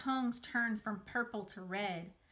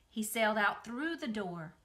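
ordinary delivery

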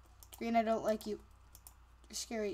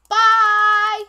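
loud goodbye; 7 semitones higher, 13 dB louder than usual. Real, not acted. Outro sign-off.

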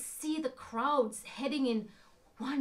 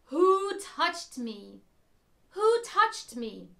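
slow, louder, kind call over a crowd; 3 semitones higher, 8 dB louder, slower than usual. Acted. Jesus asking the crowd who touched him, kind and slow. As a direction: call out slowly without anger. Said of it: "There was no anger in the voice. There was kindness in the voice".

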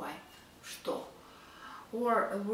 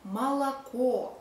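молоко with the final "KO" stressed; stress on last o; 3 semitones higher, 4 dB louder than usual. Shown as a demonstration. Teacher explaining stress, молоко as "malaKO". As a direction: say молоко as "ma-la-KO", leaning on the end. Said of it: "the last o is stressed".

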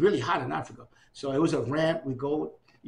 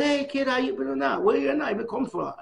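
father's voice; 10 semitones higher, 4 dB louder than usual. Retold or imitated. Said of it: "he goes".

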